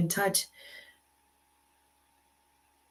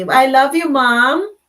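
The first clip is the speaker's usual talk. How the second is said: higher and louder, beaming call; calling out loving; 6 semitones higher, 10 dB louder, slower than usual. Real, not acted. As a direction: call out joyfully to mom. Calling out to her mother.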